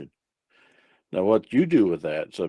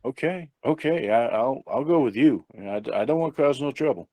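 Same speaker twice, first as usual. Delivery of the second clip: higher, hurried-to-agree stammer; meek compliance; 4 semitones higher than usual. Acted. Blind man agrees to go with officer, meekly. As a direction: give in meekly, pitch lifted.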